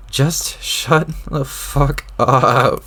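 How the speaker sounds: every syllable pressed out slowly through rage; very slow furious order. Acted. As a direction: drag out each word, seething. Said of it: "you seem really worked up".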